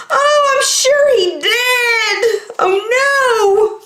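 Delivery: put-on high, wailing kid voice; fretting that Max fell in the soup; hammed-up cartoon sob; mock crying.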